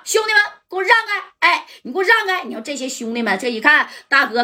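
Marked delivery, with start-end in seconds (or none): 0.0-2.4 high, raised order to his men to clear the way; shouting orders. Acted. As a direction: bark at the crew to step aside. Boss orders his men aside.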